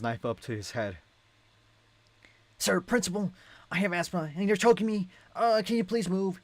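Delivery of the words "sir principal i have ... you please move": high, strained nerdy whine; choked hostage. Acted. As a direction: squeak it out while being choked. Choking hostage complains.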